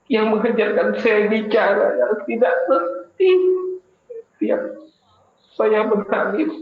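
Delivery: suara tinggi bergetar menahan tangis, pelan; menangis memohon ampun. Real, not acted. Doa tobat yang makin haru. (pendakwah berdoa tobat sampai menangis)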